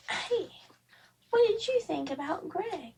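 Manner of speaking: put-on high, bright question to a child; a parent asking a kid about the story. Parent asks child about the bedtime book, high and curious.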